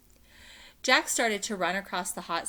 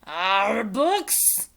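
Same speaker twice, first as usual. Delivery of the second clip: disgusted pirate; 2 semitones higher, 10 dB louder than usual. Acted. Captain disgusted.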